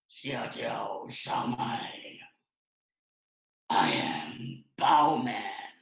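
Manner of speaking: very slow, low hero delivery; superhero voice. Acted. Bao Man answers a greeting, slow serious superhero voice. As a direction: draw out each word with heavy gravity. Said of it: "you gotta read it in that voice".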